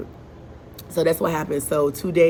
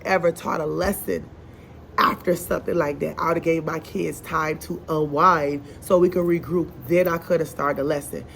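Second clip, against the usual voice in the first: ordinary talk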